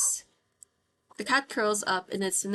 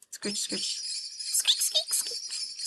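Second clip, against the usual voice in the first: softer whispered scritch and squeak; whispered scratching; 4 dB quieter, much slower than usual. Acted. Reading the mice's noises in the dark, whispered. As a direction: hush it like claws in the dark.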